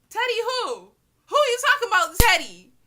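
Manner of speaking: high, loud disbelieving yell; shocked shout. Retold or imitated. Recognizing her own crush's name, yelling in disbelief.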